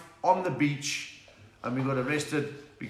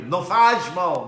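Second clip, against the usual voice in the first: loud Portuguese cheer; 3 semitones higher, 10 dB louder, much slower than usual. Acted. Portuguese phrase to fan.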